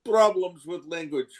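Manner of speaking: high, whiny mock Slavic accent, loud at first; Melania. Mimicking the wife being teased, whiny accented complaint. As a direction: complain like a wounded woman in a thick foreign accent. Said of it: "very strange accent".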